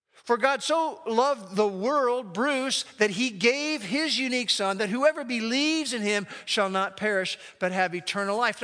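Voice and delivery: scripture reading. Acted. Pastor reading John three sixteen to the congregation, solemn. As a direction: proclaim the verse with weight.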